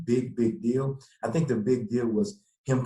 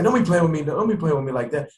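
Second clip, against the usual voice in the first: high loud mock mom, half scolding; a mother warning him not to joke, pitched up; mom's voice; 6 semitones higher, 5 dB louder than usual. Mom telling her son not to joke about the draft, high mock scold. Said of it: "She's like".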